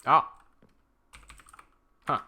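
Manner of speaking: ordinary delivery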